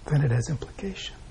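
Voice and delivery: ordinary talk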